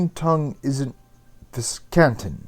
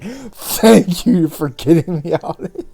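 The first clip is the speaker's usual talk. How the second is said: teary thanks; 5 semitones higher, 10 dB louder, slower than usual. Acted. Rescued girl thanks Rune, teary.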